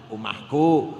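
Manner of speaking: omahku low and quiet, held back; said softly. Shown as a demonstration. Preacher shows the congregation how to say omahku humbly, quietly. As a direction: drop the voice right down on omahku, not boasting. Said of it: "cek kecil-kecil, rendah-rendah".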